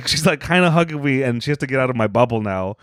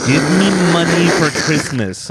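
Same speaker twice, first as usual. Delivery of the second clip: imitating mom; 5 semitones higher than usual. Mom asking her child for a Christmas gift, imitated higher voice. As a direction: put on your mother's insistent demand. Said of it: "I remember my mom would be like".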